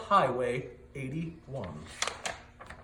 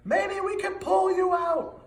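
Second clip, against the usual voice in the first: high shout from above; 13 semitones higher, 3 dB louder than usual. A narrator's reading. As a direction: call it down the well. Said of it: "I heard him shout".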